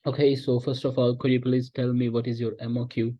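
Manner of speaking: slow, relaxed question; smooth flow. Shown as a demonstration. Teacher demos asking a supplier about MOQ. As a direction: ask it easy and unhurried. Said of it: "इस तरीके से".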